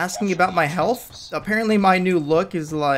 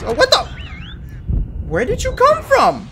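loud, very high shriek of surprise; startled yell; 7 dB louder than usual. Real, not acted. Player to enemy appearing from nowhere, startled yell. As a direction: jump into a shrill startled shout.

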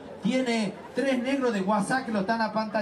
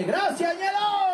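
shouted thanks; 10 semitones higher, slower than usual. Real, not acted. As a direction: call out the thanks to Ñelo across the room. Comic shouts thanks to a friend.

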